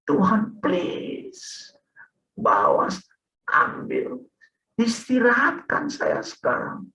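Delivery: drawn-out, high begging in broken phrases; desperate prayer. Retold or imitated. Sick man asking Tuhan for rest, desperate slow plea. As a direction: implore God to end the pain, re-enacted. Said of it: "saya langsung berdoa".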